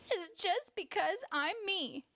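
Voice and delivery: put-on high-pitched upset child outburst; crying out. A narrator's reading. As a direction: cry it out in a high little-bear voice. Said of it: "Jazzy Bear cried".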